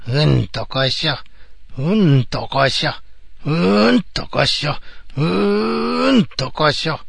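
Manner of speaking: effortful heave-ho, slowing; straining under weight. Acted. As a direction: grunt with the effort of lifting. Lifting heavy chests.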